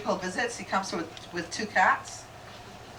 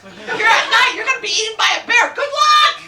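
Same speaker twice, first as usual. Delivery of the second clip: high, loud, fast jeering warning; mocking shouted warning; 11 semitones higher, 6 dB louder than usual. Real, not acted. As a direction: yell it like doom is certain.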